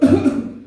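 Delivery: husband's sudden mock cough; put-on coughing; acted cough. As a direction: cough as if suddenly taken ill. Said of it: "Tiba-tiba suami mulai batuk".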